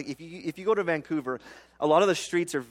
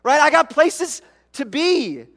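much higher, much louder defensive yell; yelling back; 12 semitones higher, 10 dB louder than usual. Retold or imitated. Driver stuck in the intersection, yelling back at honking drivers. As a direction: shout your excuse back at the honkers. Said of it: "I yell back and go".

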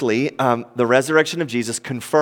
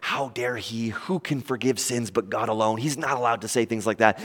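low, hushed indignant grumbling; soft, low voice of scandalized onlookers; muttering; 4 semitones lower, 9 dB quieter than usual. Retold or imitated. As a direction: mutter it under your breath to those beside you. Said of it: "are all whispering and muttering".

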